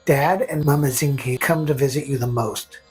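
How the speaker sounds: ordinary delivery, nothing marked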